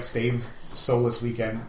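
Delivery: normal talking voice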